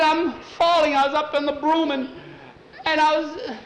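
high, frantic explaining; high-voiced boy in a panic. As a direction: gabble it loudly, still half in the nightmare. Boy frantic after nightmare.

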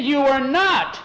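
high, loud emphatic denial; angry contradiction. A narrator's reading. Tom argues with the boys over the spot, angry contradiction.

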